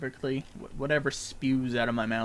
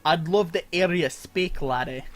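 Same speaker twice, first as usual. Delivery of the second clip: "I'd love to hear you speak, laddie" in a pirate-Scottish accent, higher and louder; 5 semitones higher, 10 dB louder than usual. Shown as a demonstration. Streamer shows chat the pirate Scottish accent, hammed up. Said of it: "a pirate slash Scottish accent".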